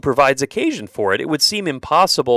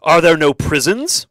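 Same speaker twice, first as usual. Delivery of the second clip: loud, higher-pitched miser's retort; Ebenezer Scrooge; 4 semitones higher, 6 dB louder than usual. Acted. Scrooge answering men asking donations for the poor, loud retort. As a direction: fire the question back, louder and higher. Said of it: "And Scrooge's response".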